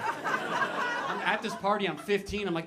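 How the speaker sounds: ordinary talk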